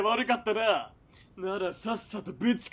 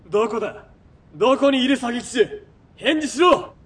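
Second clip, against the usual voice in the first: loud, raised voice across the square; calling out loud; 3 semitones higher, 7 dB louder than usual. Acted. Villain shouting a hostage deal to hidden assassins. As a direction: bellow the deal to unseen enemies, growing impatient.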